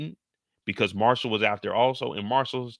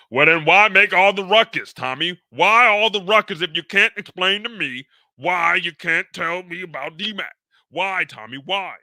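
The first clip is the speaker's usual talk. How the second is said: louder, higher, pressing for answers; demanding shout; 6 semitones higher, 9 dB louder than usual. Acted. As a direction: push him hard for an answer. Father demands answers from Tommy.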